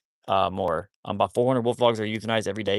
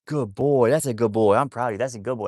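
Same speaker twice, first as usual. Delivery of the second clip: bright, louder praise for a good dog; 7 dB louder than usual. Real, not acted. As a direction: gush over the dog for coming back.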